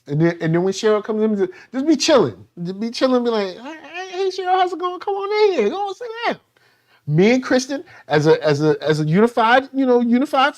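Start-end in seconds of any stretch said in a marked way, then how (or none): 3.6-6.4 high, sugary mock hospitality; fake sweet welcome. Greeting the unwanted mother-in-law Cheryl with fake high sweetness.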